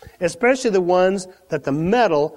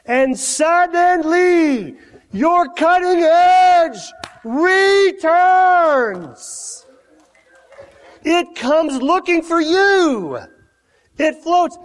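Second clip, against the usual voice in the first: high, slow, loud preaching peak; preaching at full force; 9 semitones higher, 3 dB louder, much slower than usual. Acted. Preacher promising the congregation their edge returns, loud and slow. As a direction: lift the hall up, slowly and loud.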